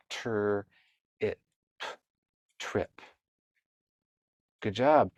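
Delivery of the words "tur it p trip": segmenting the sounds of "trip" slowly; sounding out trip. Shown as a demonstration. Teacher modeling how to sound out "trip" for a child. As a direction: stretch out tur, it, p, then blend into "trip". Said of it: "So let's read that".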